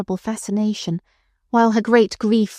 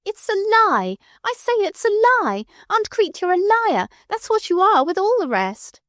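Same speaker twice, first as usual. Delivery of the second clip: screaming; 12 semitones higher than usual. A narrator's reading. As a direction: scream it at the top of her voice. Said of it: "screaming at the top of her voice".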